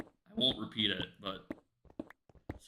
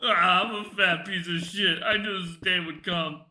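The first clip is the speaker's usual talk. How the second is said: fake crying; 6 semitones higher, 5 dB louder than usual. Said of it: "it's like".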